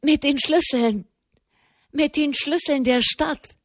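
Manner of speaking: breathless excited blurt; excited stammer. Acted. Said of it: "brachte Tommaso di Petra heraus".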